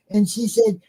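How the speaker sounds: usual speaking voice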